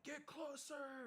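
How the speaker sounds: high, slow drawn-out command shout; barked order. Retold or imitated. Instructors shouting at recruits in the pool, commanding. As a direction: yell it like a drill instructor. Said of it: "they're like".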